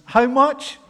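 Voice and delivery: loud, high rising call to the crowd; prompting question. Acted. Preacher calling out to the congregation for the answer. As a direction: fire the question out at them.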